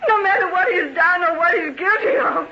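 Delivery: fast, weeping, breaking into a cry; crying out her love. Acted. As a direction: sob out that you still love him. Helen declares love, weeping.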